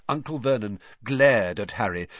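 usual speaking voice

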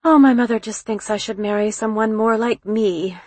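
usual speaking voice

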